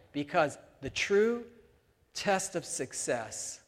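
slow down to land the key point; slow emphasis. Real, not acted. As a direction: lean on true and test. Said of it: "sermon key point".

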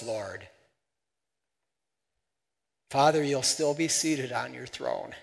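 hushed pastoral prayer. Real, not acted. Prays closing prayer.

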